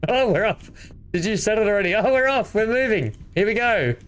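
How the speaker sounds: high, delighted burst of real excitement; excited. Real, not acted. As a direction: cheer as the ship takes off. Ship finally jumps to warp.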